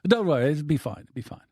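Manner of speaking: put-on Italian accent. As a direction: brush it off in a rough Italian accent. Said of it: "He was an Italian guy".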